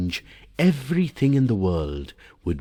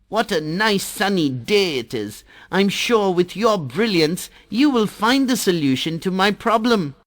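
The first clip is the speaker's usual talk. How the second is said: put-on high, sweet child voice, flattering; butter up the Sun in a high bright voice; little water drop sweet-talking the Sun, voiced high; Jal the water drop; 13 semitones higher than usual. Jal's flattering greeting.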